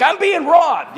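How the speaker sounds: high, loud frantic cry; panicked yell. Retold or imitated. Telling the screaming bystanders he's being robbed, high loud yell. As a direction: shout it out like you're being robbed. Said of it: "And I'm like".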